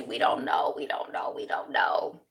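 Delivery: hushed, repeated whisper; playful whispered suspense; conspiratorial whisper. Real, not acted. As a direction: whisper it like a mystery. Mock suspense about a conspiracy.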